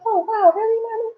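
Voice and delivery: very high little-girl voice, mock amazed; mock-impressed child. Daughter to mom, high mock-amazed child voice. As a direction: squeak it out like a wide-eyed kid. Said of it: "she's like".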